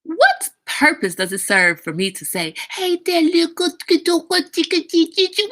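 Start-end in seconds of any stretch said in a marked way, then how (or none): 2.7-5.5 put-on much higher, silly gibberish baby voice; mocking baby talk. Mocking adults who babble at babies, high silly voice. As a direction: ham up the goo-goo talk. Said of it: "what is that?".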